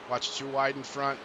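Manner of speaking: ordinary delivery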